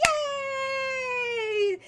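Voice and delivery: very high shouted cheer; Tiny celebrating the kiss; cartoon cheer. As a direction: squeal with cartoon joy. Kiss succeeds in the story.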